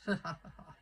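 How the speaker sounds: mock laugh of the big guy; imitated laugh. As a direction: imitate his menacing laugh after the threat. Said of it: "And he laughed like that".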